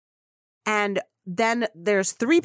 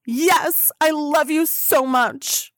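higher, slower, louder declaration; gushing fan; 6 semitones higher, 4 dB louder, slower than usual. Retold or imitated. Fan answering Kim's selfie offer, gushing loudly. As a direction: pour it out, overeager. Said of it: "I said".

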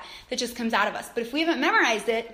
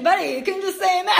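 mock scolding while laughing; amused teasing quote; laughing tease; 6 semitones higher, 5 dB louder, faster than usual. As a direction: rib him through a laugh. Teasing husband's long prayer.

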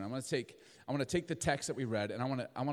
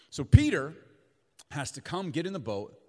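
normal talking voice

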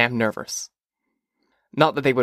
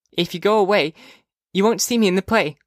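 put-on high, plaintive child voice; funny-voiced young girl; 8 semitones higher than usual. A narrator's reading. Sister to brother about missing her play, high funny voice. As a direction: plead gently as a little sister. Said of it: "Her voice sounded funny when she said it".

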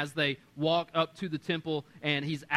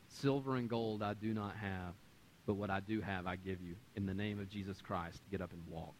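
softer, low and grave; hushed apostle's command; solemn, hushed command; 7 semitones lower, 9 dB quieter than usual. Acted. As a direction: speak the healing words quietly. Peter heals the lame man.

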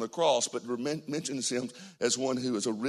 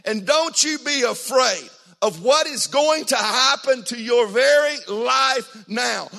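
loud, high, slow shouted preaching; impassioned sung-out sermon; full-force preaching climax; 11 semitones higher, 10 dB louder, slower than usual. Acted. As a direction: thunder it out to the church.

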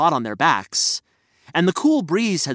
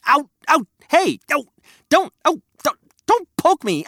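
high yelping hedgehog; 11 semitones higher than usual. Acted. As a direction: squeal as you get poked. Hedgehog being poked.

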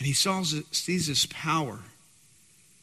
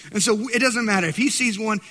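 ordinary talk

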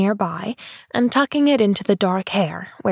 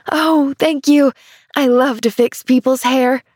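louder, higher, overjoyed thanks; exulting; 5 semitones higher, 5 dB louder than usual. Acted. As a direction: gush with delight. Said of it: "exulted Pollyanna".

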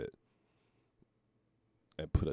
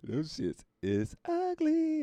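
slow high disgust; much slower than usual. Acted. Judging the shoes.

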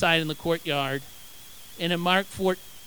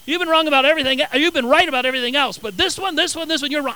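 higher-pitched, mock-insistent protest; insistent contradiction; 6 semitones higher, 3 dB louder, faster than usual. Peter telling Jesus he is wrong, insistent. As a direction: argue it urgently, pitched up.

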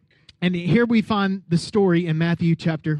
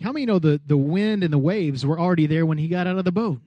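ordinary talk, nothing marked

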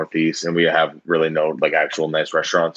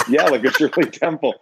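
speaking through the tail of a laugh; 7 semitones higher than usual. Real, not acted. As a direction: still laughing as you say it. Laughing at her own story.

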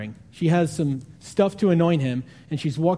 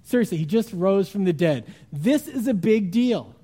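ordinary talk, nothing marked

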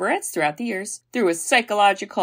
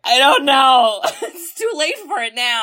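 high, laughing through the words; can't stop giggling; 7 semitones higher, 4 dB louder than usual. Real, not acted. Laughing while retelling.

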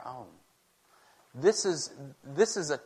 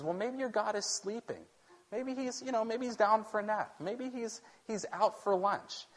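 higher, sing-song mock sympathy; mocking taunt; 8 semitones higher than usual. Elijah taunting the prophets of Baal, mocking. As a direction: tease them like their god is napping. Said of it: "Elijah begins to taunt the prophets".

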